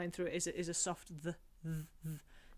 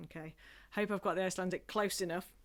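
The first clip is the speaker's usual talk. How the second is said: ordinary delivery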